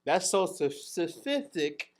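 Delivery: "sophistic" stumbled out in place of "specific"; mangled pronunciation. Real, not acted. Host fumbles "specific" as "sophistic" to friends, stumbling pronunciation. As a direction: trip on "soph-", then drag out "sophistic". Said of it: "when your pronunciation is like this".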